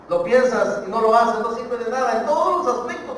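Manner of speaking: loud, insistent preacher's delivery; full-force preaching. Real, not acted. Preacher urging the congregation to act, not just think, preaching loudly.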